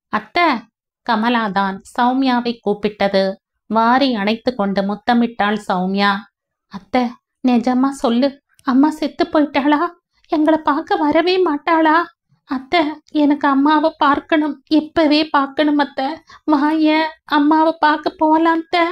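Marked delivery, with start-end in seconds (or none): 0.1-0.5 put-on higher, rising call of a small girl; little girl calling higher. Child calls her aunt again. 6.7-18.9 put-on higher child's voice, begging for her mother; a small girl pleading with her aunt; pleading little girl. Narrator voices little Kamala.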